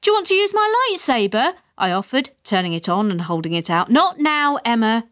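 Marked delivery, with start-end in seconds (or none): 0.0-1.5 put-on high, eager little girl; eager child. A narrator's reading. Emma offers her father the lightsaber: eager child. 3.9-5.1 mild snap. A narrator's reading. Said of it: "snapped my mother".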